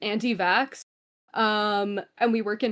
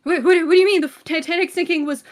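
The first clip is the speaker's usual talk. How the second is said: loud, fast shocked outburst, higher; retold disbelief; 5 semitones higher, 9 dB louder than usual. Retelling her shocked reply to an anti-vax coworker, loud and incredulous. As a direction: blurt it out in stunned disbelief. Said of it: "And I was like".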